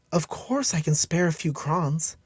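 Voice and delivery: put-on high, slow, giving young woman; kind young woman. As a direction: offer help gently and kindly. Young woman voiced by narrator.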